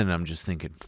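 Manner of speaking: ordinary talk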